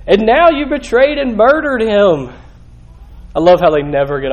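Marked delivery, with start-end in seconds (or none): 0.0-2.3 high, forceful preacher's indictment; fired-up scripture quotation; impassioned accusation. Acted. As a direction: accuse them, getting louder on the last charge. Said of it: "He goes on, he says".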